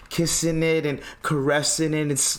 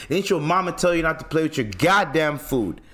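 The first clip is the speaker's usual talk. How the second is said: usual speaking voice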